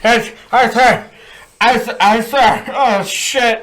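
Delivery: high, loud cries of hurt; hammed-up pained yelping; mock pain. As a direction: groan as if just struck. Acts out pain after being hit.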